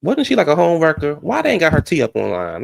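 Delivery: loud, nosy gossip question. Real, not acted.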